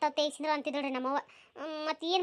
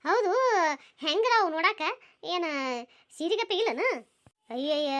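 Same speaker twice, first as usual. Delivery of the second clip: normal talking voice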